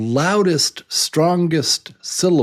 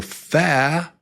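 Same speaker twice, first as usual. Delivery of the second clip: fa pitched high and held; stressed, slower syllable; 8 semitones higher than usual. Shown as a demonstration. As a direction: lean long on fa. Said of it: "loud and a little slower".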